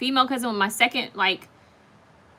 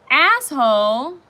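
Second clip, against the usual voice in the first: loud, slow, cutting "Asshole."; loud drawn-out jab; annoyed insult; 3 semitones higher, 7 dB louder, slower than usual. Real, not acted. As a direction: throw it at the commenter. Insulting a commenter.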